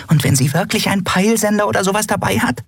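very high, fast little creature voice; squeaky, hurried worrying; agitated. Acted. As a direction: fret rapidly in a tiny high-pitched voice. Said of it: "Nelen flatterte aufgeregt hin und her".

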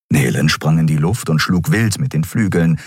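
usual speaking voice

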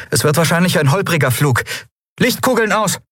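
high commanding call; captain giving orders. Acted.

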